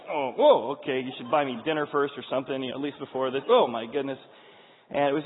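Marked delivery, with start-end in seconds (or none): 0.1-3.4 mock alarm, easing into a quip; startled protest. Man reacting to a security pat-down, startled protest. 3.5-4.2 mock shock. Acted reaction to pat-down.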